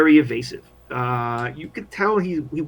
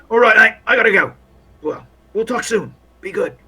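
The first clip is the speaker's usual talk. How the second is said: higher, breezy goodbye in character; overly cheery soldier; 6 semitones higher, 7 dB louder than usual. Acted. Soldier leaving his new pals, overly cheery. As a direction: sign off brightly as a chummy soldier. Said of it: "He seems overly cheery".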